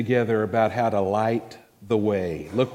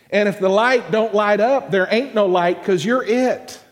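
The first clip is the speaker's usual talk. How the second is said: higher and louder, building to a shout; rising preaching; 7 semitones higher, 4 dB louder than usual. Real, not acted. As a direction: build it up like a preacher at full force.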